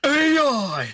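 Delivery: high, loud, cartoonish squeal of A I; shrill mock yell. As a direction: yell it like a dopey sidekick. Mock shout at game AI.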